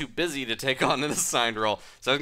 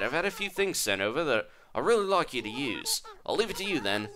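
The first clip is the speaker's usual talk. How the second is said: higher, half-English Australian lady voice; dubious Australian accent; 6 semitones higher than usual. Acted. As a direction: gush in a badly faked Australian accent. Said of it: "Is this sounding Australian at all".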